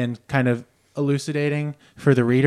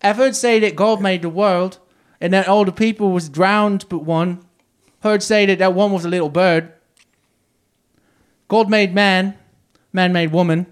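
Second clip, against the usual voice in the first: a hint of a Victorian boy's accent, pitched higher; child's accent; 6 semitones higher, 3 dB louder than usual. Reading a child laborer's words in a light English accent. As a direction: read the quote as a lightly voiced boy with a touch of English accent. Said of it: "Don't do the accent".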